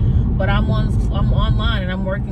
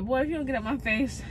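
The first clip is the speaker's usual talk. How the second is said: mock sassy brush-off; 5 semitones higher, 7 dB quieter than usual. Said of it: "I'm like".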